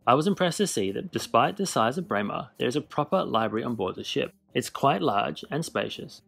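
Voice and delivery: normal talking voice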